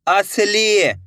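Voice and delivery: असली with final ली held long. Shown as a demonstration. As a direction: stretch the last syllable of असली. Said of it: "मेरे साथ साथ इनको repeat करना है".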